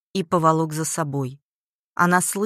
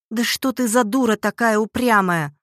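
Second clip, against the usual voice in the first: higher, louder, exasperated scolding; exasperated outburst; 5 semitones higher, 6 dB louder than usual. A narrator's reading. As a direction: snap at a stubborn daughter in exasperation. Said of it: "в сердцах воскликнула женщина". (в сердцах, с досадой)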